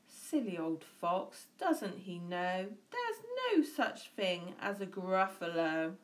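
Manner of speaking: plain reading voice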